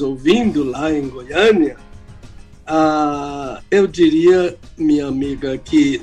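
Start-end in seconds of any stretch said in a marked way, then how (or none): none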